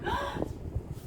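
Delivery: a tiny breathy gasp of delight; whispered gasp. Shown as a demonstration. Marvelling at her gentle stroke, whispered gasp. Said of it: "how gentle".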